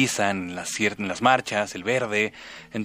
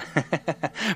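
short laugh; 6 semitones higher, 5 dB quieter than usual. Real, not acted.

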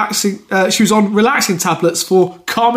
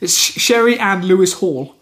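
usual speaking voice

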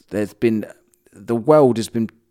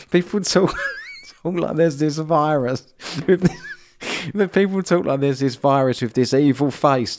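laughing while talking; 5 semitones higher than usual. Real, not acted. Laughing while mocking the idea.

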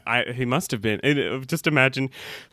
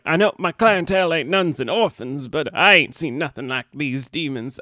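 put-on Southern belle accent. Willie talks of his clientele, Southern belle accent. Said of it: "doing a Southern Belle accent as well".

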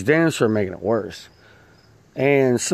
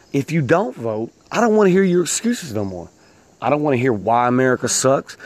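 normal talking voice

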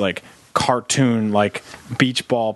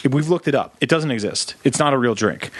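ordinary talk, nothing marked

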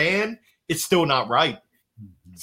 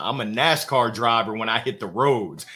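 normal talking voice

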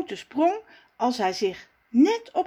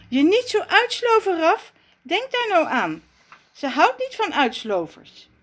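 higher, eager little figure voice, reminding himself; lightly voiced Raf holding himself back; Raf's voice; 8 semitones higher, 3 dB louder than usual. (Raf spreekt zichzelf toe, iets hoger)

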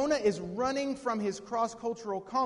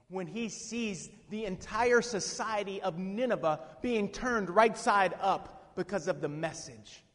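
ordinary talk

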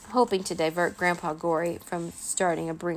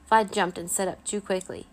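ordinary delivery

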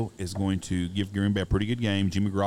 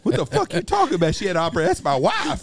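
high, loud, laughing outrage; hammed-up furious husband through laughter; mock outraged husband; 9 semitones higher, 5 dB louder than usual. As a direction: squeal it out in disbelief. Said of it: "He says".

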